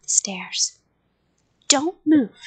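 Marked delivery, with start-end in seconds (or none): 1.7-2.4 loud, raised command; shouted. A narrator's reading. Man to the child in the basement, shouted. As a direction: shout a short warning. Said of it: "he shouted".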